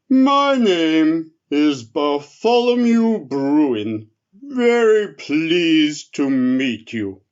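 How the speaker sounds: slow, loud, lumbering bear voice; a big drowsy bear just woken, yawning through it; sleepy friendly bear. Acted. The bear asks the elf for soup, slow and sleepy.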